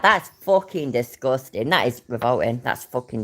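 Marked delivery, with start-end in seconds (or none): none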